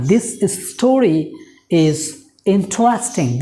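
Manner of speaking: each word set apart by pauses; teacher dictating slowly. Shown as a demonstration. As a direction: spell the sentence out word by word. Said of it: "teacher models with pauses between words".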